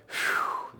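long voiceless whew; weary exhale. Retold or imitated. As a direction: blow out a tired breath. Weary at six hours left.

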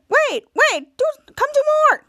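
high, loud, rushed pleading; eager begging. Retold or imitated. Ray begging Charlotte to keep sitting on him, high eager rush. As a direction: call her back, squeaky and hurried.

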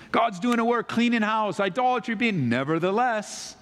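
high, run-on list of good news; animated preacher's build toward a turn; excited build-up. Shown as a demonstration. Building up good news before \"nevertheless\.

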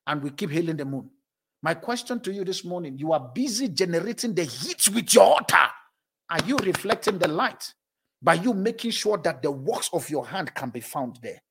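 normal talking voice

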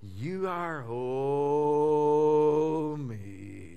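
drawn-out gloomy groan, sinking at the end; long, mournful sigh of a voice; depressed Eeyore. Acted. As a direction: moan it slowly like a dejected donkey. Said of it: "Hang around Eeyore".

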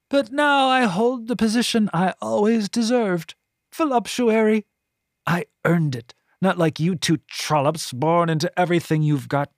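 put-on high, disdainful old woman voice; a scornful, self-pitying matron; bitter voluptuary. A narrator's reading. Voluptuary character voice.

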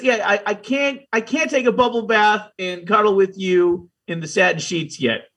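usual speaking voice

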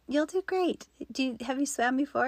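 a hint of a kind older swim instructor; older woman's encouraging voice. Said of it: "And she goes".

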